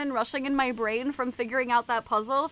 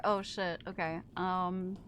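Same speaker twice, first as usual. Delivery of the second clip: usual speaking voice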